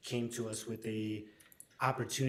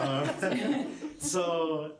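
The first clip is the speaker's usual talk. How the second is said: laughing; 7 semitones higher, 8 dB louder than usual. Real, not acted. Laughs after colleague's compliment.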